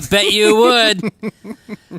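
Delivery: loud, high laughing burst, drawn out; laughing retort. Real, not acted. Co-host teasing a friend about dropkicking for a record, laughing retort. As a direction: crow it slowly through a laugh.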